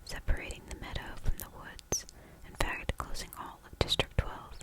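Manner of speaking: ordinary delivery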